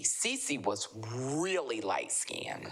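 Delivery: "really" drawn out long and slow; stress on really. Real, not acted. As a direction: lean hard and slowly on "really". Stretches "really" for emphasis.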